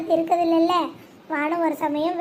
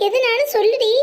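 raised, louder worried plea; alarmed urging; 4 semitones higher, 5 dB louder than usual. Acted. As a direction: press her anxiously to speak, pitched high. Urges crying friend to speak. (பதட்டத்தோடு வற்புறுத்தி)

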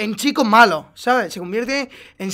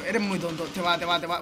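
ordinary talk

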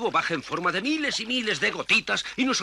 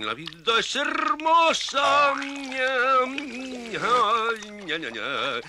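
dreamy contentment; 4 semitones higher, much slower than usual. Acted.

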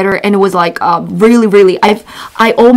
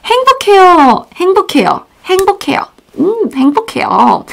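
high, bubbly happy voice; happy demo; 7 semitones higher, much faster than usual. Shown as a demonstration. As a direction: say it beaming with joy. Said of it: "And then you can say".

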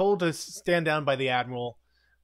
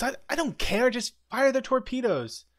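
pitched-up exasperated insistence; a raised, impatient mock order; higher flustered scientist; 9 semitones higher than usual. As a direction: blurt it out, flustered. Flustered scientist impatiently demands firing.

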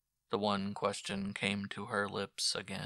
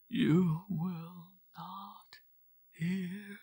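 very slow, higher, trailing whimper; whimpered despair; 10 semitones higher, 4 dB louder, much slower than usual. Acted. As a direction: give up hope, dragging each word. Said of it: "she whimpered".

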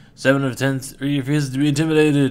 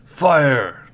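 roared attack command; battle shout; 7 dB louder than usual. Acted. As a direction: bellow the order to fire.

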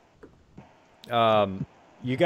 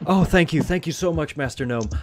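grateful townsperson; 3 semitones higher than usual. Acted. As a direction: gush thanks, then offer formally. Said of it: "and he goes".

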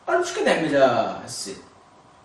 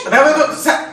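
laughing protest; 10 semitones higher than usual. Real, not acted.

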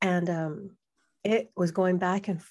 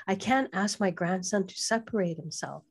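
normal talking voice

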